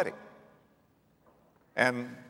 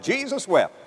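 proud recitation; 8 semitones higher than usual. Shown as a demonstration. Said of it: "Try to pull that".

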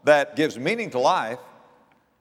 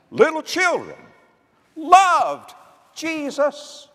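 preaching at full force; 13 semitones higher, 5 dB louder than usual. Acted. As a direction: lift it high and preach it warmly. Preacher rises to high pitch.

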